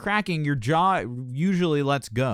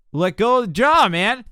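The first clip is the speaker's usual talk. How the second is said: "Let go of the jaw" called out much higher and louder; emphatic call; 10 semitones higher, 8 dB louder than usual. Real, not acted. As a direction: shout the instruction like a coach pushing a student. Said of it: "coach repeats his instruction forcefully".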